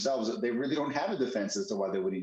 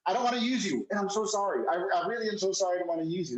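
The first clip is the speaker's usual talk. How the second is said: mock apologetic client; 7 semitones higher, faster than usual. Host mimics the apologetic client.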